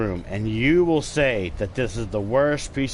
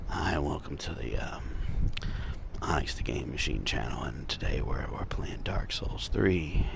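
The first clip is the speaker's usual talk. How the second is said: ASMR whisper demo; 8 semitones lower, 7 dB quieter than usual. Shown as a demonstration. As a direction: whisper it like an ASMR channel intro. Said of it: "if I was gonna do it like this".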